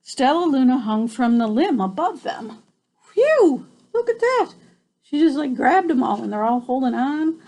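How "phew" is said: loud high sigh of relief; relieved phew. Real, not acted. Reader relieved the birds are safe, loud high phew. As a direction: blow out relief.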